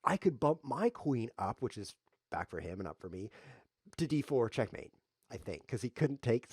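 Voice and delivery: ordinary talk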